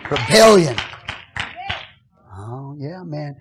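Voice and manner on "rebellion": loud, rising preacher's climax; full-force preaching. Acted. Pastor preaching loudly to his church. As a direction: preach it hard to the congregation.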